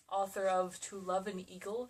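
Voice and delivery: ordinary delivery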